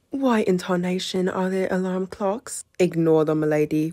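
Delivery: lightly faked British gentleman; a hint of a posh British voice; mock British accent. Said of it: "I turned British".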